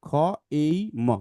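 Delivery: slow, letter by letter, then the whole word became; sounding out syllables. Shown as a demonstration. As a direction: break the word into pieces for the child, then join them. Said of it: "ভেঙে পড়ো, দুই ভাগ করো".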